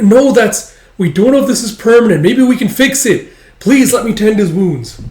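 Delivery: high, loud protest; urgent pleading. Acted. Yun begs to tend dragon's wounds, urgent pleading.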